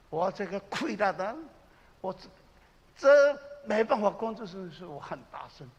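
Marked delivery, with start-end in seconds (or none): none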